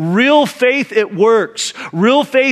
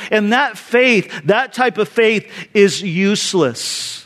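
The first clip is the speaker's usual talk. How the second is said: ordinary delivery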